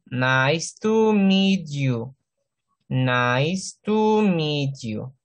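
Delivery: Nice to meet you, very slow and spelled out; slow model phrase. Shown as a demonstration. Teacher slowly modelling the greeting for students. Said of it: "esto no es to".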